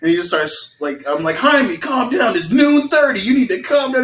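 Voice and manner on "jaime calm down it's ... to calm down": urging calm. Retold or imitated. Roommate retelling how they urged a tripping friend to settle, high and pleading. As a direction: retell it pitched up and slowed, urging him down.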